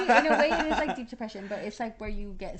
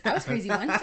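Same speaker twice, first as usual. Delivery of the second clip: mock recital; 8 dB louder than usual. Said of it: "then you repeat it".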